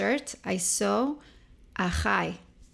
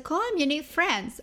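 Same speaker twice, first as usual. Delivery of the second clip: much higher, pushy urging; bossy cousin; 8 semitones higher than usual. Retold or imitated. Older cousin pushing her to call him, high and pushy. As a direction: nudge her insistently.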